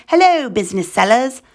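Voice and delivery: put-on trying on a British accent; badly faked British hello, hunting for the accent; attempted British accent. Host reading UK seller news, attempted British accent. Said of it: "Should I read this in a British accent?".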